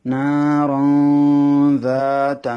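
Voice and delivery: নারান যাতা chanted slowly, tanwin held in the nose; slow tajweed chant; ikhfa nasal hold. Shown as a demonstration. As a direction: draw out নারান then hide the n before যাতা. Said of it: "সেজন্য এখানে গুণনা করতে হবে".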